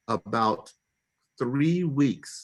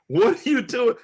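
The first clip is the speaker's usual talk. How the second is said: higher, louder baffled demand; taken aback, voice jumping up high; startled disbelief; 12 semitones higher, 7 dB louder than usual. Retold or imitated. Husband reacting to his yelling wife, startled and loud.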